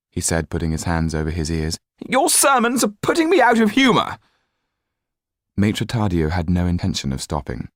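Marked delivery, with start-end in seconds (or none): 2.1-4.2 very high, loud refusal; petulant outburst. A narrator's reading. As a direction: shut the lawyer out like a spoiled child. Marquis covering ears.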